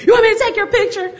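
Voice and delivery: a bright, speedy imitation of the little instructor; instructor's offer. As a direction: chirp it fast and high like the helpful instructor. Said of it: "He's like".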